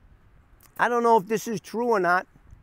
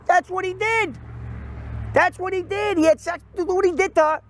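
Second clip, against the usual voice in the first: telling the producer what happened, high and emphatic; excited retelling; 11 semitones higher than usual. Retold or imitated. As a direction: lay it on like juicy gossip. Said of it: "I go".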